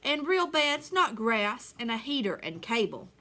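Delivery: lively storybook read-aloud; reading to children. Acted. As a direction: read the rhyme to the class with bounce. Teacher reads picture book aloud.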